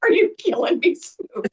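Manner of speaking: hammed-up shocked mom; mock mortified. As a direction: playfully scold the star. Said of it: "I was like".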